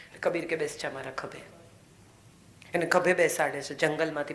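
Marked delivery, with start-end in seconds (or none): 0.6-1.4 low and soft, sit on my shoulder; quiet gentle command. Acted. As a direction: hush it tenderly. Kabir gently says sit.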